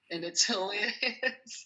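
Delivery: a laugh breaking into the words; chuckling through the word hilarious. Real, not acted. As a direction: laugh it out, slowly. Laughing at colleague's repeats.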